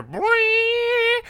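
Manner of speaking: very high, loud held note; imitated entrance music. Fan imitating Brock Lesnar's entrance music, loud high screech. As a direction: mimic the screeching sting, high and sustained. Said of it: "And then I hear".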